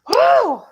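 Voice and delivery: high, loud celebratory whoop; excited woo. Real, not acted. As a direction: cheer out loud for joy. Celebrating the end of readings.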